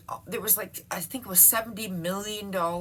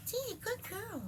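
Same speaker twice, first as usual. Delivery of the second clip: much higher, cooing pet voice; 10 dB quieter than usual. Real, not acted. To her golden retriever, high cooing baby talk. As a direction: coo it up high like talking to a puppy. Praising dog, second take.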